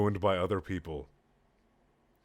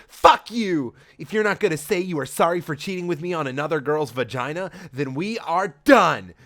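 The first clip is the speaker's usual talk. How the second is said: higher, louder hammed-up rage; mock shout; 6 semitones higher, 6 dB louder than usual. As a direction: roar the breakup melodramatically. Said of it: "shouted Sonic".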